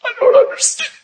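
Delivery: very high, squeaky mock bewilderment; high-pitched mimicry. Preacher quoting her to the congregation, high-pitched mimicry. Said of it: "she said".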